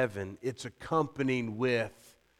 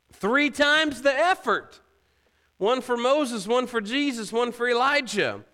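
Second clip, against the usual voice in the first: high, loud, animated pitch for Peter; playful enthusiasm; 12 semitones higher, 6 dB louder than usual. Acted. Preacher crediting Peter's effort to build three, playfully excited. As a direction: talk it up with hammed-up eagerness.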